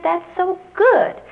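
high, slow, delighted praise. Retold or imitated. Teacher praising her elderly pupil, delighted. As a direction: gush over his progress.

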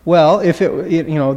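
ordinary delivery

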